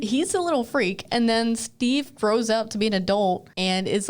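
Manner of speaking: usual speaking voice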